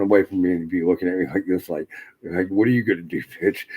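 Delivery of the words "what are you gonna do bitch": louder, cocky challenge; mock taunting, pushed out loud; dog's taunt. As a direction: voice a defiant dog daring its owner. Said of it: "what he was saying to me".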